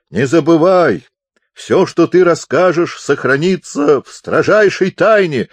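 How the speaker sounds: high, loud, hearty bellow. A narrator's reading. Deaf chaplain promises Pupser secrecy, bellowing. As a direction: yell the confidential promise out. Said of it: "орал он".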